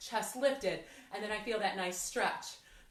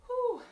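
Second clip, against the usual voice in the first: high exhaled "whew"; recovering whew; 11 semitones higher than usual. Real, not acted. Instructor winding down near the end of class, high relieved whew. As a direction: let out a quick high breath of relief.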